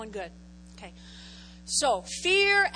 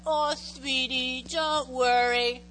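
mock sweet comfort; slower than usual. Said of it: "He's not coming up".

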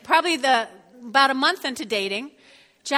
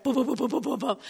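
rumble strip noise; faster than usual. Acted.

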